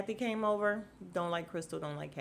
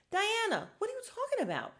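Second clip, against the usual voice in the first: very high-pitched exasperated challenge; squeaky-high, incredulous calling-out; incredulous outburst; 13 semitones higher than usual. Acted. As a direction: shoot up high in mock disbelief. Exasperated challenge to Diana in retelling.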